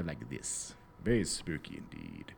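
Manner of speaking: slow, low creepy horror-host voice; Vincent Price. Shown as a demonstration. Host demonstrates an old creepy-movie actor, slow spooky voice. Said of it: "my creepiest Vincent Price voice".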